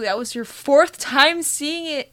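loud, high playful accusation; excited. Real, not acted. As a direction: call her out, half laughing. Teasing accusation among friends.

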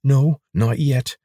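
guard answering through a clenched jaw, slowly. Acted. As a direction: grind each word out.

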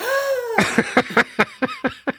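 high, sharp imitated gasp; collective gasp. Crowd in the stands watching the fall, high gasp. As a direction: suck in air in shock. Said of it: "this collective".

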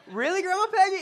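high, loud disbelief; incredulous reaction. Acted. Bystander stunned that Grandma's back is healed, incredulous. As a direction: squeal it in astonished disbelief.

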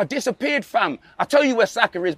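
ordinary talk

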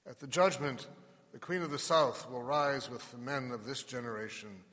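measured, solemn scripture reading; gospel reading. Acted. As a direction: proclaim it from the pulpit. Reads the gospel at Mass.

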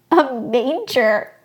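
slow, loud, swooping disbelief; loud, drawn-out astonished echo; incredulous repeat. A narrator's reading. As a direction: stretch it out in amazement. Amazed repeat of the shepherd's words.

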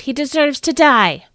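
high, loud angry yell; screaming. A narrator's reading. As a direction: scream for his death. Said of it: "they screamed".

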